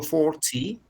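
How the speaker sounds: "forty" with a crisp clear t, British style; British accent. Shown as a demonstration. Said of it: "es British". ("forty" con acento británico, la t clara y marcada)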